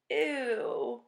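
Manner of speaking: high, loud grossed-out ew; a child recoiling at slimy fish, grossed out; disgusted ew. Acted. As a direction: pull away from the slimy fish.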